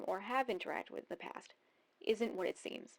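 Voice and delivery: ordinary talk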